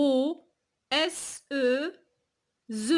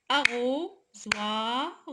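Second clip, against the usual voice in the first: "Arrosoir" slowly split as ARRO, SOI, R; syllable by syllable; much slower than usual. Shown as a demonstration. Teacher counting syllables for pupils, "Arrosoir" slowly broken up. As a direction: beat out "Arrosoir" slowly with pauses between syllables. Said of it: "trois syllabes".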